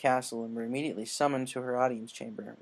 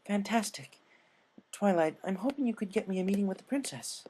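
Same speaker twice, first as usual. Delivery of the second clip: higher elegant lady pony voice; higher voiced elegant unicorn with an idea; refined Rarity; 7 semitones higher than usual. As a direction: play a poised, eager fashionista.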